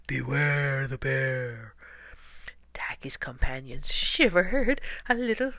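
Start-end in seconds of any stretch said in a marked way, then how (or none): 0.1-1.7 low, drawn-out growly bear call; slow, menacing bear growl. A narrator's reading. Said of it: "a great growly voice called out". 3.9-5.5 stuttering, shivery scared voice; higher, quivering with fright; shivering scared. A narrator's reading. Narrator shivers like the frightened penguins.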